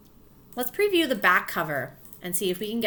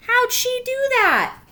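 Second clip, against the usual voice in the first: very high, slow, louder astonished voice; amazed; 7 dB louder, slower than usual. Acted. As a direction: sound stunned by the baby. Amazed grown-up voiced in story.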